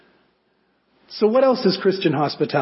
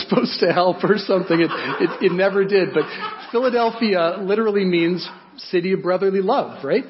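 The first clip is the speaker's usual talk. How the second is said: normal talking voice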